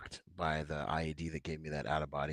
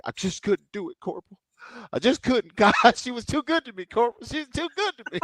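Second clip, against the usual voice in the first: high, loud lovesick wail through laughter; heartbroken mock pleading; 15 dB louder than usual. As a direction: imitate a lovesick Marine pleading, pitched way up. Retelling heartbroken Marine confessing to corporal.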